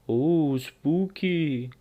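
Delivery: slow, drawn-out mock-spooky ooh; sarcastic spooky. Elliot mocking the Halloween hacker post, drawn-out sarcastic spookiness. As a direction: tease it like a campfire ghost story.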